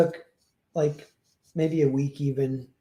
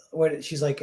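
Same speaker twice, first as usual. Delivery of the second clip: normal talking voice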